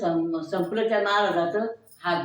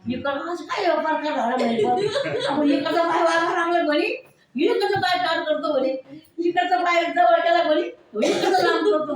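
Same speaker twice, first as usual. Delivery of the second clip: laughing amazement; 8 semitones higher, 3 dB louder than usual. Retold or imitated. Villager marvels at twitching legs.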